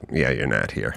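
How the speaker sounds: bored poll worker. Retold or imitated. Poll worker dismissing the voter, low soft deadpan. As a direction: drop it low and flat like a tired clerk. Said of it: "And the guy was like".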